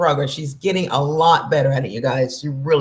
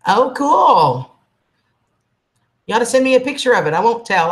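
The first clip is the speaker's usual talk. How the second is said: excited; 6 semitones higher, 4 dB louder than usual. Real, not acted. Excited reply to chat.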